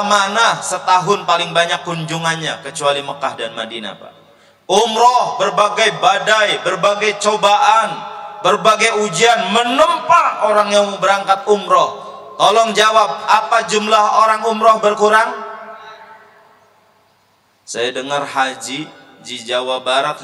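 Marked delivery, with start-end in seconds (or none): 4.7-15.3 higher, louder sermon push; fiery preaching. Real, not acted. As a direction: rouse the congregation. Preaching at full force. (khotbah yang berapi-api dan lantang)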